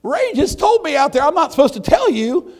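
loud, high outburst; preaching shout. Acted. Preacher shouting what he held back, full force. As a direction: blast it out to the congregation.

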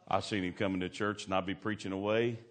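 ordinary talk, nothing marked

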